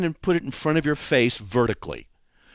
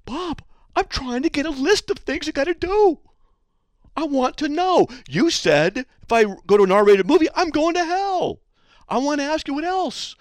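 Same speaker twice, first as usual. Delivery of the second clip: much higher, mock-soothing sing-song; 14 semitones higher than usual. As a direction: talk down to him like a fussy child. Host mocks guest, mock-soothing.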